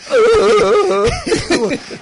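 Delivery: loud, high burst of laughter; big laugh. Real, not acted. Reacting to mate stuck in a revolving door, laughing loudly. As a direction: crack up at the image.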